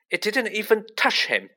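high, indignant protest; shaking with anger. A narrator's reading. Harry denying Ernie's accusation, angry and high. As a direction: protest it angrily, pitch jumping up. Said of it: "his voice shaking with anger".

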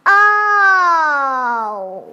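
long, loud falling "oh"; loud cry. A narrator's reading. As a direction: let out a big drawn-out cry of release. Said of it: "发生个很响的声音".

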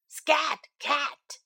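a lightly voiced skunk, changed timbre; the skunk sending the cat off; a different, odd-sounding "Scat Cat"; skunk shooing.

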